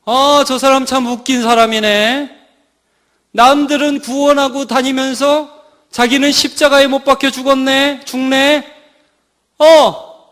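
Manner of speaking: loud mocking taunts, rising in pitch; hammed-up scornful crowd jeer; jeering passersby. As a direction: heckle the crucified man from below. Acts out passersby taunting Jesus.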